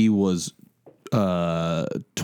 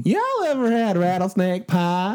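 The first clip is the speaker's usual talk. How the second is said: put-on higher twangy old-timer voice; old prospector; 9 semitones higher than usual. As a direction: play a grizzled old prospector. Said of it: "Mine sounds like an old prospector".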